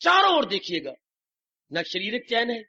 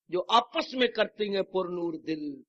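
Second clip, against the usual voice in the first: usual speaking voice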